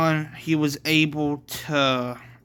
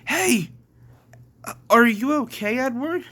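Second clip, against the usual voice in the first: high-pitched concerned call; worried call; 10 semitones higher, 3 dB louder than usual. Acted. Dylan runs to fallen Edward, worried and high. Friend checks on fallen character.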